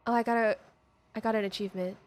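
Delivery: normal talking voice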